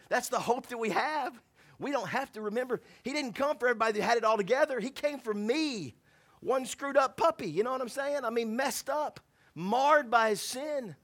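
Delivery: rising, loud preacher's fervour; preaching at full force. Acted. Pastor to his church, fervent preaching. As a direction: preach it hard to the church about grace.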